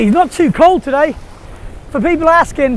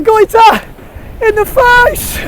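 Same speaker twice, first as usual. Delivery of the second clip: higher, louder, playful ribbing; excited mock jeer; 10 semitones higher, 4 dB louder, slower than usual. Real, not acted. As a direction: tease a friend over a wipeout, keyed up. Excited commentary on a mate.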